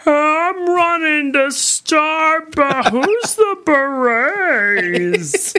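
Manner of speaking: slowed-down slow-mo voice, high and dragged; slow motion. Pretend. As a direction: stretch every word like a slow-motion replay. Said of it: "you talking in slow-mo sounds like".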